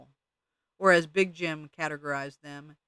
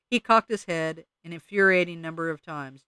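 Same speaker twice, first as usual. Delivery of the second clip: ordinary delivery, nothing marked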